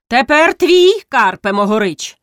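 high, loud teasing call; joking. A narrator's reading. A tall villager jokes with Karpo, teasing. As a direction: tease him good-naturedly. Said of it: "жартуючи".

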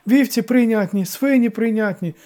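high, loud recital; obedient, delighted servant; joyful eagerness. A narrator's reading. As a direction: reel off the rules eagerly. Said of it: "радісно закивав староста".

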